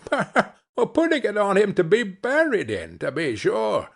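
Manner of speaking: high, amused reply with a laugh in it. A narrator's reading. The woman to old Joe about the burial shirt, laughing. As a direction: toss it off with a laughing lilt. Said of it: "replied the woman with a laugh".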